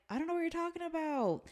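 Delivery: high, fast, bewildered quote; confused protest. Retold or imitated. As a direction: squeak it out like a baffled girl. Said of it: "the Melanie girl's like".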